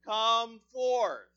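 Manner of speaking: "Come forth" shouted high and loud; commanding shout. Acted. Jesus commands Lazarus out of the tomb, shouting. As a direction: command the dead man to rise. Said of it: "and he's speaking to you now".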